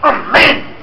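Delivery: ordinary delivery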